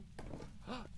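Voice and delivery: quick breathy intake of air; sharp gasp. Real, not acted. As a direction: gasp as if startled. Entering the basement.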